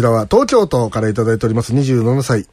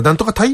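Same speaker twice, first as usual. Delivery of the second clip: タイ turned into a high-pitched question; mock Kyushu なんとかタイ, pitched up as a question; question by accent alone; 7 semitones higher, faster than usual. Shown as a demonstration. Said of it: "それ言わないだろ？".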